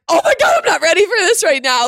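very high, loud squeal of overwhelm; overwhelmed cry. Retold or imitated. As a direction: shriek like the moment hit too soon. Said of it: "and I was like".

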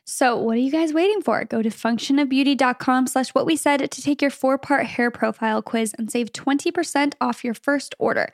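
bright upbeat sponsor read. Acted. Podcast host reading a sponsor spot, upbeat ad read. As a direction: sell it like a podcast ad.

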